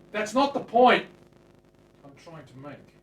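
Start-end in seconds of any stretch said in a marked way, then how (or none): 0.1-1.0 loud, much higher burst; exasperated outburst. Real, not acted. Cutting off his own rambling, loud exasperated outburst. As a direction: blurt it out in frustration.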